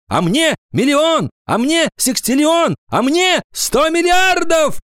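put-on high, excited children calling out; outbidding cries. A narrator's reading. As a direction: build to a drawn-out topper. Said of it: "раздалось в разных углах класса". (выкрики из разных углов)

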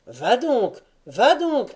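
swooping, rising insistence; impatient urging. A narrator's reading. Zaporogues to reluctant new chief, impatient urging.

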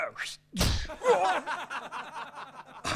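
big shouting laugh. Acted. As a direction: burst out in a hammy laugh. Laughs after threat.

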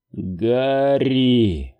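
long-held creaky name. A narrator's reading. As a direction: draw the name out like a summons. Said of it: "скрипучим голосом".

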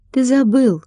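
high, gently patronising tone; condescending. A narrator's reading. Girl reminds the boy as if he were little, condescendingly. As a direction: say it as if to a small child. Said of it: "сказала она снисходительно, как маленькому".

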